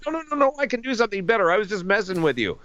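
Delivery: high, rapid-fire backpedalling; flustered mock plea in a high voice; frantic protest. Retold frantic protest to the director.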